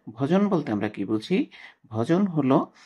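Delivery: usual speaking voice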